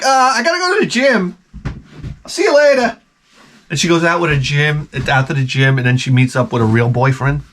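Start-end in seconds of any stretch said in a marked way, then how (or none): 0.0-3.0 high, breezy put-on voice; dismissive wife voiced way up high; mock wife brush-off. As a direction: play her airily dodging the kiss. Voicing the wife brushing him off.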